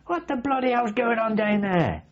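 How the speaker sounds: high, exasperated yell down the hatch; retold angry yell at the driver; exasperated shout. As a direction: demand to know what is going on.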